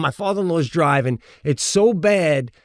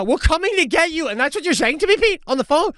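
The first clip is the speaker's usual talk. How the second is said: imitating yelling wife; faster than usual. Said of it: "just yelling at me".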